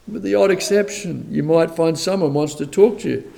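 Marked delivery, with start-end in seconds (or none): none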